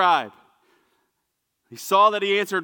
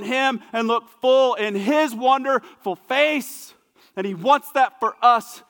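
higher, louder repeated refrain, slowing on key lines; preaching at full force; 6 semitones higher, 5 dB louder than usual. Real, not acted. Preacher to Hill City, rising to full preaching force. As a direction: build it like a revival sermon.

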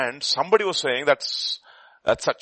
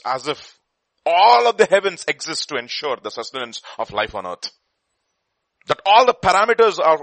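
preaching; 5 semitones higher, 8 dB louder than usual. Acted. Preaching the fine-tuned universe.

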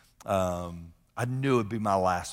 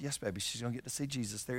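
put-on quick, much softer reassurance to a child; tender reply to daughter; 10 dB quieter, faster than usual. Father gently answers his little daughter, soft and quick. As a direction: hush her gently while holding back tears. Dad soothing daughter in car.

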